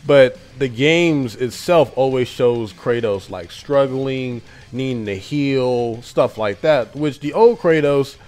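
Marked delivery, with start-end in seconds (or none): none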